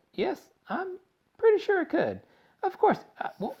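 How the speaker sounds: very high squeaky musing; Wonka's odd squeak. A narrator's reading. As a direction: squeak it out uncertainly.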